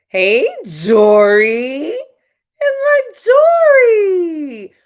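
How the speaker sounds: very high, loud, slow hollered hello; delighted shout. Real, not acted. Host shouting a happy hello to Jory joining. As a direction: shout a joyful welcome. Greeting relative joining stream.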